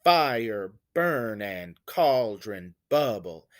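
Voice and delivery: slow falling beats on "Fire", "burn", "cauldron", "bubble"; first syllables stressed. Shown as a demonstration. Teacher showing the first syllable is stressed, trochaic beat. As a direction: hit the start of "Fire" and "bubble", drop the rest. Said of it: "the first syllable is stressed".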